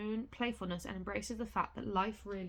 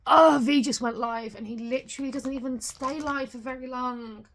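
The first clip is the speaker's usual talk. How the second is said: excited; 4 semitones higher, 12 dB louder than usual. Real, not acted. Excited about V going live.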